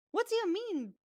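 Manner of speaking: sharp high disbelieving question; shocked captain. Acted. Captain stunned by news of broken anchor, high disbelief. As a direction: jump up in pitch, stunned.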